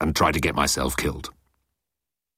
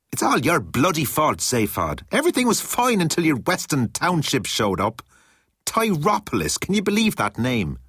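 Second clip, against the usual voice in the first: Hillman, indignant; 9 semitones higher than usual. A narrator's reading. Hillman complains to Zaphod.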